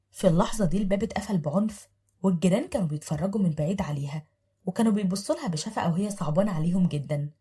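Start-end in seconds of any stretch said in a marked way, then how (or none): none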